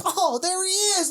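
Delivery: very high mock exclamation; mimicking the agent. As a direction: imitate a giveaway cry of spotting someone. Mimicking agent's giveaway on spotting them.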